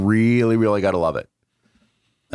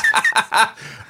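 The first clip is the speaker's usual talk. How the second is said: high breathy laugh; burst of laughter. Real, not acted. Laughing at the robot voice.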